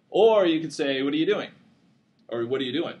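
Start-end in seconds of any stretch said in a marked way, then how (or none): 1.0-1.5 quick run-together words, higher and louder; smashed together. Shown as a demonstration. Lecturer showing students unsegmented speech, words smashed together. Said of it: "all sort of smashed together". 2.4-2.9 quick, casual run-on phrase; smashed together. Shown as a demonstration. Lecturer giving another everyday take, words smashed together. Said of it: "all sort of smashed together".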